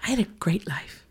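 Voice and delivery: whispered wistful line, imitating; breathy whisper. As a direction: hush it out, sighing. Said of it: "so she was like".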